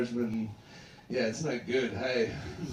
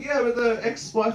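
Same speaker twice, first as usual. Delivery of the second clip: retold prodding; 10 semitones higher, 4 dB louder than usual. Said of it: "I'm like".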